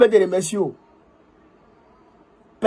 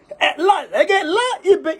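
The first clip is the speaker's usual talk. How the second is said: laughing; 12 semitones higher, slower than usual. Real, not acted. Mocking laugh at a report.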